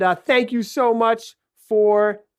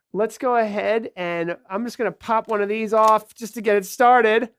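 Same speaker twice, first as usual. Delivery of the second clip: ordinary talk, nothing marked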